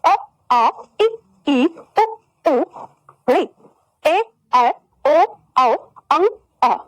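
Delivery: slow, spaced-out letter drill; vowel recital. Shown as a demonstration. Teacher reciting all the letters for pupils, each sounded separately. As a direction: model each vowel separately, with pauses between. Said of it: "सारे वर्णों का उच्चारण कर रही हूँ".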